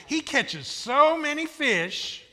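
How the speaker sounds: SO stretched long and high; slow, high, amazed build on "so many fish"; stress on SO. Shown as a demonstration. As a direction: draw out "so" for the crowd. Preacher hams up repeated punchline.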